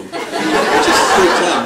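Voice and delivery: real laughter at the memory; laughing. Real, not acted. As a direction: stretch it out while cracking up. Laughing while telling the punchline.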